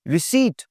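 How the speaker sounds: "रिसीट" as ri-SEET, no p; silent p. Shown as a demonstration. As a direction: leave the p out of "रिसीट". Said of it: "पी की आवाज़ नहीं आएगी जी".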